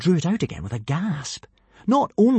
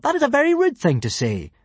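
put-on protesting boy; 14 semitones higher, 4 dB louder than usual. A narrator's reading. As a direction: object with hurt pride. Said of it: "protested Hiccup".

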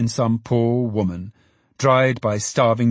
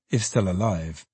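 ordinary delivery

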